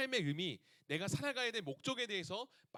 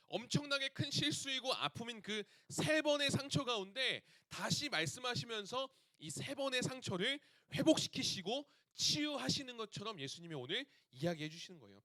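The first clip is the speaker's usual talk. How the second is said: ordinary talk, nothing marked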